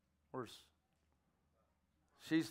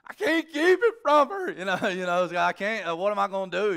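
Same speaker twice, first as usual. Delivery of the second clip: high, loud call up the bank; hollering; 6 semitones higher, 9 dB louder than usual. Retold or imitated. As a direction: shout it up to her, choked up. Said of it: "I'm hollering up to Heather".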